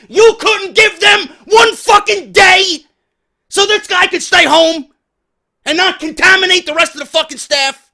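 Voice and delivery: loud high angry rant; shouting angry outrage; furious rant. Real, not acted. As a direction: vent at the manager. Ranting about manager.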